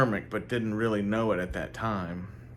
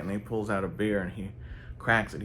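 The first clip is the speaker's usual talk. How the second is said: ordinary delivery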